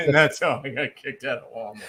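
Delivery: punchline repeated through laughter; laughing mimicry. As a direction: echo the joke while cracking up. Repeats punchline while still laughing.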